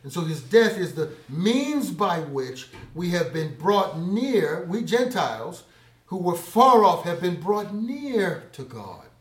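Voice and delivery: loud pulpit proclamation; preaching loudly. Acted. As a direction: lift the verse to the room. Preaching climax.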